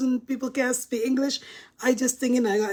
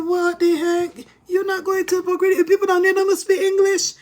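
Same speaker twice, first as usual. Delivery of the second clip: put-on mother scolding her teen, shouting high in disbelief; mother refusing the Puerto Rico trip, loud and high; shocked mom yelling; 8 semitones higher, 5 dB louder than usual.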